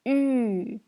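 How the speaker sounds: "U" as a long rounded ü; French-style u held long on "U"; pronunciation demo. Shown as a demonstration. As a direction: sustain "U" loud and level, lips rounded. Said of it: "ça se prononce".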